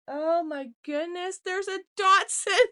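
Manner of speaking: real high, loud burst of tearful surprise; overwhelmed, near tears. Real, not acted. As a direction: cry out, choking up with delight. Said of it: "I'm gonna cry".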